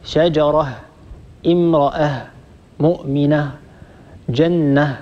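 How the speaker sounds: شجرة ending on a still h, not t; stopping on ha. Shown as a demonstration. Teacher shows the pause form ending on a still h. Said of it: "نقول".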